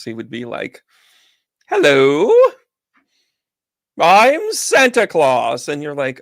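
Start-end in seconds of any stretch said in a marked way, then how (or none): 1.7-5.7 high, loud, slow booming Santa; John Lithgow as Santa. Retold or imitated. John Lithgow hamming a Santa hello. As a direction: ham up a grand Santa greeting. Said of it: "if John Lithgow was Santa Claus".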